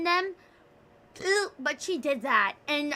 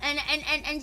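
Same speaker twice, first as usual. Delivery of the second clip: ordinary talk, nothing marked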